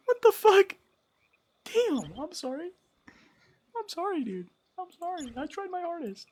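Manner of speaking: very high voice overtaken by laughter; laughing helplessly. Real, not acted. Streamer apologizing to chat, high and laughing. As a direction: squeak it out through laughter.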